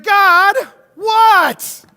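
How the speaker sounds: high, loud exasperated whine at God; whiny pity party voice. Retold or imitated. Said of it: "Kind of that whiny kind of voice?".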